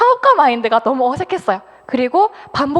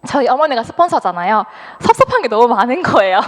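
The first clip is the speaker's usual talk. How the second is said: normal talking voice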